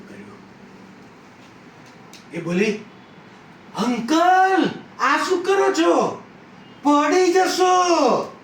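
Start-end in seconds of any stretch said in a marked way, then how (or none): 3.8-8.4 high, loud cry of alarm; shocked shout to someone about to fall; alarmed warning. Acted. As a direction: call out urgently, stretching the last word. Acted woman warns he will fall.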